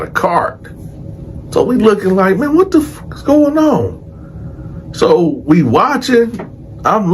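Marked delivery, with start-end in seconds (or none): 2.4-4.9 high, drawn-out bewildered question; retold bewilderment, stretched out high; baffled. Inmates watching the captain arrive, baffled.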